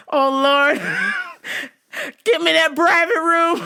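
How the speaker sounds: high, loud hammed-up begging; mock thrilled plea. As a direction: cry out as if overcome with lust. Acts out smitten reaction.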